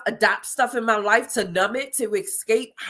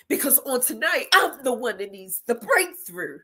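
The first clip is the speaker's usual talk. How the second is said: normal talking voice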